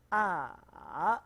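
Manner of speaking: "A" falling, then rising, loud; third tone. Shown as a demonstration. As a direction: drop "A" and lift it again. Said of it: "Turun kemudian naik".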